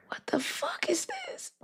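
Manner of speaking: whispered, very soft bafflement; hushed disbelief. Real, not acted. Host baffled by what just appeared, hushed whisper. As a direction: whisper it under your breath, baffled.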